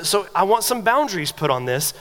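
usual speaking voice

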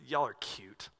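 low, quiet, indulgent God voice; a low, lightly voiced amused Jesus. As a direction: talk down fondly like God to children. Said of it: "But to him, that's like".

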